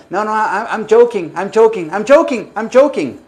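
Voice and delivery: agudo, rápido, retractándose entre risas fingidas; excusa en broma. Pretend. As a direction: recula como si fuera chiste. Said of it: "les dicen". (cuando el juez se enoja, excusa en broma)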